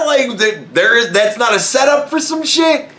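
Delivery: high, incredulous, fresh from laughing; laughing disbelief. Retold or imitated. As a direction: protest in high-pitched amused disbelief. Incredulous, just burst out laughing.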